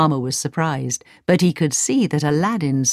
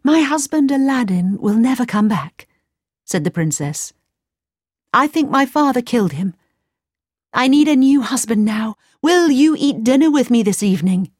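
higher, sweet princess voice; cartoon princess; 6 semitones higher than usual. A narrator's reading.

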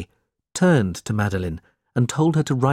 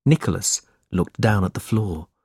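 plain reading voice

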